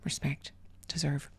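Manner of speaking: soft mocking whisper. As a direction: breathe the words with sarcasm. Mocking the offended words.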